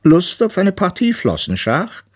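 high-pitched walrus character voice; weises Walross. A narrator's reading. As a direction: invite to a game in a high walrus voice. Walrus figure invites bear to play. (hohe Walrossstimme, einladend)

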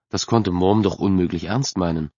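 ordinary delivery

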